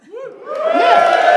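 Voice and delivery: loud, high cheer; triumphant shout. Real, not acted. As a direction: hype the crowd with a big shout. Hyping crowd over oversold show.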